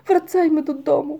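high, loud plea; pleading exclamation. A narrator's reading. Paszczak urges the crew to go home, pleading loudly. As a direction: call out to head home.

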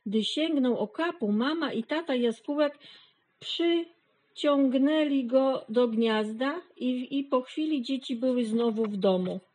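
ordinary delivery

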